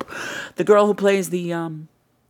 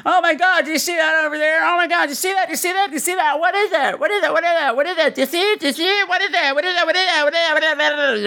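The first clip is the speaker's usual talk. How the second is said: high, excited, repeated panic; overreacting; 10 semitones higher, faster than usual. Mocking bad improv actors in a high overexcited voice. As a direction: ham up the overreaction. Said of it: "people react too much".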